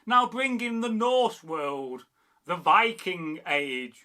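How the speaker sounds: usual speaking voice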